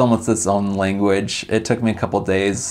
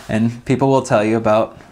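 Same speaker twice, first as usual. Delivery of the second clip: ordinary delivery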